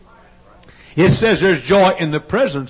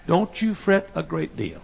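ordinary delivery

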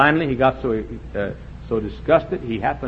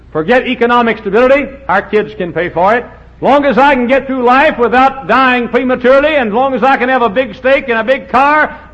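louder, higher, hammed-up voice of a greedy citizen; mock selfish American; 8 semitones higher, 7 dB louder than usual. Preacher mocking the average American's greed, hammed-up. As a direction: sneer it out as the careless spender.

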